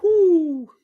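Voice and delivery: long high, loud whoo; whoop. Real, not acted. Reader hyping up the Scorpio reading, high whoop. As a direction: let out one high, falling whoop.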